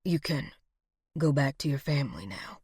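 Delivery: low, soft, halting voice; weakly dying. A narrator's reading. Dying man releasing her to family, low and faint. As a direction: speak gently as strength fades.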